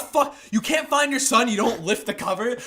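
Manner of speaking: re-enacted angry telling-off; mad. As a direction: scold in disbelief, loud and high at the start. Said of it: "I was so fucking mad".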